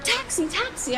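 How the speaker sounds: high calls for a cab; hailing a taxi. Retold or imitated. Rushing out of the airport, calling out high for a taxi. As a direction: call out high to flag a cab.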